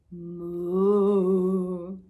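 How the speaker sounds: low, loud cow moo. Acted. Introduces the cow.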